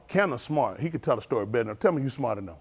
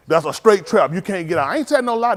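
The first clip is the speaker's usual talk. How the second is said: ordinary talk, nothing marked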